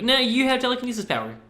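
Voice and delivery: offhand, high-pitched, soft dismissal; casually handing over a superpower; nonchalant. Retold or imitated. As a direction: toss it off like it's no big deal. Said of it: "like, nonchalant and just like".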